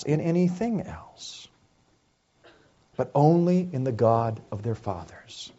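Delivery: soft, measured, reverent delivery; solemn hush. Real, not acted. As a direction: bring it right down, quietly earnest. Solemn hush after dramatic climax.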